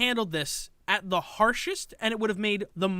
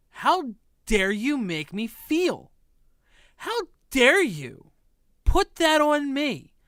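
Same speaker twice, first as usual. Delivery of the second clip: mock outrage; 3 semitones higher, slower than usual. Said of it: "mocking the streamer's outrage".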